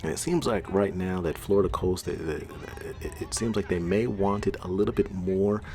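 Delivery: normal talking voice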